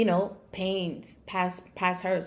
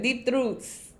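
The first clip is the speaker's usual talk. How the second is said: put-on higher, playful would-be Russian accent; mock Russian accent; 5 semitones higher than usual. Host wondering who speaks like that, mock Russian accent. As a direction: try it in a guessed-at Russian accent. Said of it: "Who speaks like that? ... Russians, maybe?".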